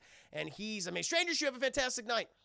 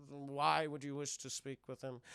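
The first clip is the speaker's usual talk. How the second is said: Alan Rickman butler; 5 semitones lower, 3 dB quieter than usual. Retold or imitated.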